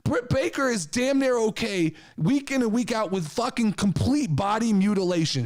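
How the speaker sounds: usual speaking voice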